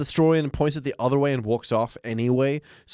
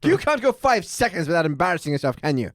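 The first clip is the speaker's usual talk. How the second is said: Abe Simpson impression; 7 semitones higher than usual. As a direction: quaver it like a cranky grandpa. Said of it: "Abe Simpson being like".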